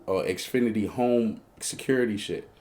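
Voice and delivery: normal talking voice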